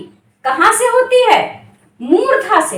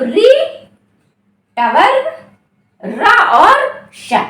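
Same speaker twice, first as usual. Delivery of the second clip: slow listing; 4 semitones higher, much slower than usual. Shown as a demonstration. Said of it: "इनका उच्चारण स्थान क्या है?".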